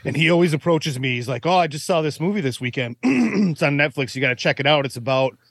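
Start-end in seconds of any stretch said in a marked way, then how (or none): none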